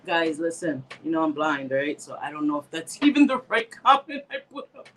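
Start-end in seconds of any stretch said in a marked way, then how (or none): none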